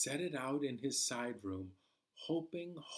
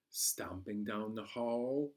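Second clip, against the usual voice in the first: ordinary delivery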